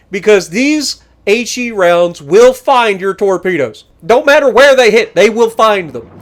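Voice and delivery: loud, slow-building proclamation; emphatic warning. Real, not acted. Gamer warns torpedo ships about his rounds, emphatic. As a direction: hammer home the threat with relish.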